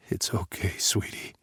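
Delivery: soft, hushed comfort; tender reassurance. Acted. Father soothing his crying daughter, soft and hushed. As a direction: hush her gently, barely voiced. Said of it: "clamp down on my emotions to assure her".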